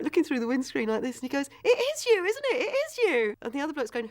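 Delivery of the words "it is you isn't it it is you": high-pitched, amazed recognition; excited retold policeman. Policeman recognizing the actor through the windscreen, excited. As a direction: imitate a thrilled fan spotting a celebrity. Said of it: "And he's so excited".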